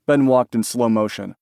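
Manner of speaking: usual speaking voice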